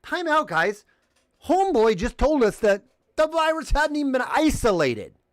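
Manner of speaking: high and loud, calling a time out; exasperated outburst. Retold or imitated. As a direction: cut into the debate, raising the voice. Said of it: "I'm like".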